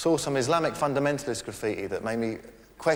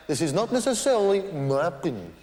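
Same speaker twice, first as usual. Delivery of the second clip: Brian Walden impression; 5 semitones higher than usual. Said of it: "simply by saying".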